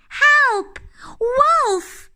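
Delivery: very high, loud panicked cry; shouting for help. Acted. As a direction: scream for help as she runs.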